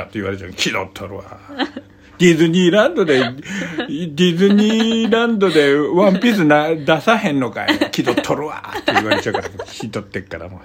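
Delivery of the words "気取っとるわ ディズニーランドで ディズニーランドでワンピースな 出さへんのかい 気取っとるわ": mock Kansai heckle. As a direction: tease like an Osaka local scoffing at Tokyo. 関西人のツッコミを真似る. (笑いながら関西弁でツッコむ)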